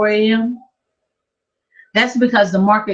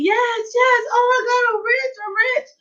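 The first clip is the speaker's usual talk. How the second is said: high, squealing shouts of joy; retold high-pitched squeal of triumph; joyful scream. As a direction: shriek it like you just got rich. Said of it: "I'm screaming".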